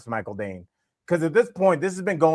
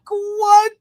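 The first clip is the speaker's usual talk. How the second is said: startled what; 7 dB louder than usual. Retold or imitated. Startled reply to stranger.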